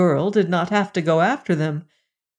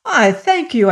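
eager thanks; 6 semitones higher, 4 dB louder than usual. A narrator's reading. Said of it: "cried he".

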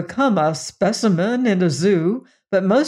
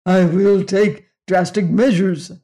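threatening alien; 5 dB louder than usual. Acted.

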